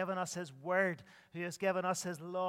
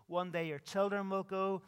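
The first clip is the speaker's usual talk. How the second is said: ordinary talk, nothing marked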